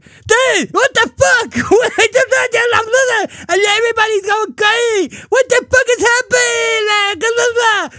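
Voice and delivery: very high, loud mock yelling; mock deaf-accent shout. Cashier yelling at Dave after the mix-up, high mock shout. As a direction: shriek it high and slow in her voice. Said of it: "she's like".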